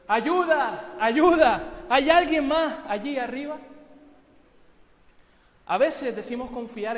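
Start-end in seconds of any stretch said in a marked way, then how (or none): 0.1-3.6 very loud, high desperate yell; shouting for help. Acted. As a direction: scream for anyone else up there. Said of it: "finalmente Jack gritó". (grito desesperado y muy fuerte)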